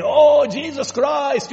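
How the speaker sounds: high, drawn-out imitation of an exclamation; mock exclamation. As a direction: stretch it out like someone mimicking an oath. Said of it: "I'm just saying what they say now".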